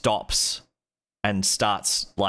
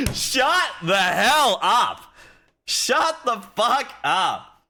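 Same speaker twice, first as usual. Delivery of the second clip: high, slow, stretched-out yell; hammed-up stretched shout; mock shout; 11 semitones higher, 4 dB louder, much slower than usual. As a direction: drag out the yell, high and loud. Hammed outburst in chat.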